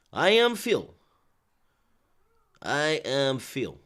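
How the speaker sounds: drawn-out Ukrainian accent impression. Streamer imitating a heavyweight boxer, Ukrainian accent. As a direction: put on a slow, mock Ukrainian accent. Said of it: "Did I say it in the right accent".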